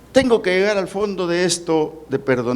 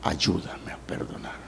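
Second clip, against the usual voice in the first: a whispered prayer for help, much softer; whispered plea; 8 semitones lower, 9 dB quieter than usual. Acted. Kneeling parent begging the Lord, whispered plea. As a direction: breathe the prayer out. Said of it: "de rodillas llorando".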